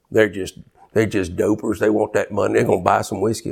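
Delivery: a low, scornful brush-off, imitating a stingy churchgoer; dismissive scoff. Preacher mocks churchgoers who ignore the beggar, scornful. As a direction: wave the beggar off as a doper.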